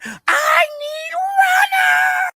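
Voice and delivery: high, long drawn-out kitchen holler; mock-yell it, stretching every word way up high; imitated yell. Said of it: "Bernadette is yelling".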